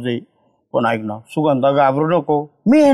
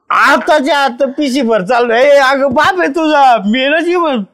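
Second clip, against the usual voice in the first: insistent scolding; 6 semitones higher than usual. Acted. Father insists she come home.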